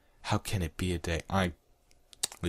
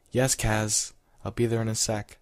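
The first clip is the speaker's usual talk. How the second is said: raised, louder answer; calling back; 5 semitones higher, 5 dB louder than usual. Acted. He answers Karen down the corridor, calling back. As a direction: call back higher, over a distance.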